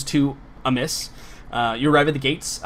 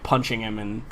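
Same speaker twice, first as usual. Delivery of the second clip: ordinary talk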